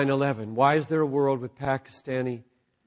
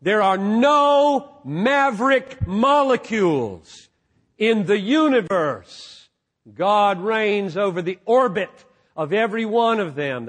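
quoted conviction; 7 semitones higher, 6 dB louder than usual. Retold or imitated. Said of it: "with R C Sproul".

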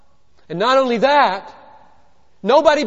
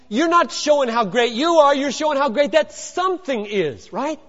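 normal talking voice

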